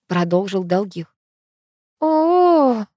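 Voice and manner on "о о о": high, long disappointed "ooh"; long high moan of disappointment; disappointed drawn-out "oh". A narrator's reading. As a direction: draw out a let-down "oh". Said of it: "протянула я разочарованно".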